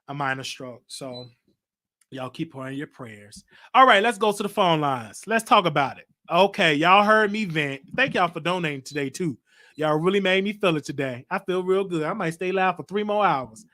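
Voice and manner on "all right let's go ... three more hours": loud call-in show host; radio host. Acted. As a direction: open the phone lines like a DJ. Host takes callers.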